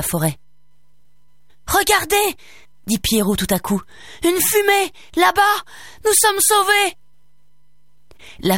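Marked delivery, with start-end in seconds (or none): 1.7-2.4 high, sudden cry of discovery; excited call. A narrator's reading. Boy suddenly spots smoke. 4.2-7.0 high, elated exclamations; excited relief. A narrator's reading. Boy announces they are saved.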